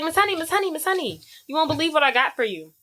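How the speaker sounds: high, fast, eager calling; excited child. Retold or imitated. Matilda runs to Miss Honey with news, high excited calls. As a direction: burst with news for the teacher.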